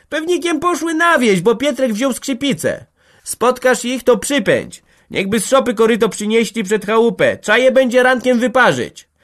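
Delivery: very high, loud farmyard shouting; high-pitched hollered instructions; calling out. A narrator's reading. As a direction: shout it across the yard. Shouted exchange across the farmyard.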